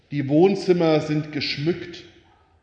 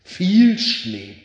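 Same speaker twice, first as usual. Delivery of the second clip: enraptured polar bear; 4 semitones higher, 5 dB louder than usual. Acted. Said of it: "schwärmte verzückt".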